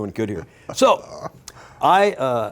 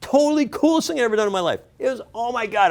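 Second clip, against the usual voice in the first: excited; 12 semitones higher, faster than usual. Real, not acted. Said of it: "it was so cool".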